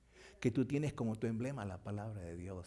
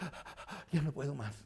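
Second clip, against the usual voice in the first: exhausted gasping; 3 semitones higher than usual. Acted.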